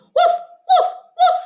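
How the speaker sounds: high, loud playful woof woof; cartoon puppy bark for children; dog barking. Shown as a demonstration. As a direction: bark like a little firehouse dog. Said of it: "the sound that the firefighter dog does".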